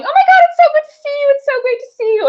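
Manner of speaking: put-on very high, fast, loud girly squeal; gushing girls. Girls at the bar greeting her, high fast gushing squeal. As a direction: gush like long-lost best friends. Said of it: "they jumped on me going".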